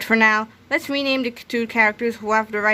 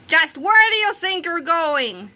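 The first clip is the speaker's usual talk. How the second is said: slow, higher scolding demand; bossy shout; 7 semitones higher, slower than usual. A narrator's reading. Melvin-as-Krupp scolding the secretary, slow and higher. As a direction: demand it slowly like a boss. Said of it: "shouted Melvin".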